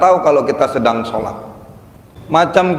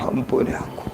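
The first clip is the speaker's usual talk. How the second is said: breathy whisper begging forgiveness; whispered plea; 10 dB quieter than usual. Shown as a demonstration. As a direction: whisper it humbly, almost to yourself. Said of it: "ibu baca".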